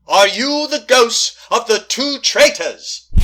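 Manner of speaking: slow, loud summons to the spirits; calling out. Acted. Medium calling the spirits, slow and loud. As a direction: call out to the ghosts, drawn out.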